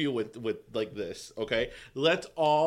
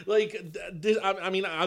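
normal talking voice

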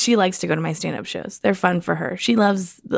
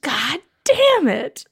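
slow, higher mock exasperation; frustrated dad; 5 semitones higher, much slower than usual. Daughter showing dad's habit, drawn-out mock frustration. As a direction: stretch out the curse as a fed-up dad. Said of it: "So he does that. He'll just".

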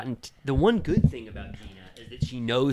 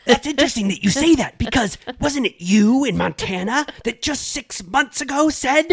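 high, louder catty mock accusation; a pitched-up, slowed gotcha in her voice; mocking Tamara; 12 semitones higher, 7 dB louder than usual. Host mimics Tamara springing a gotcha on Heather, high and loud. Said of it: "She goes".

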